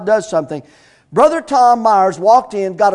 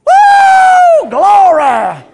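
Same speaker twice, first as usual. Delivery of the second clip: a whoop far higher and louder, then a loud praise; preacher rejoicing before the congregation, shouting praise; joyful shout; 12 semitones higher, 9 dB louder, much slower than usual. Real, not acted. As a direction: burst out celebrating in church. Church celebrating the announcement.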